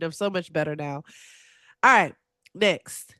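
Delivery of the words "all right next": ordinary delivery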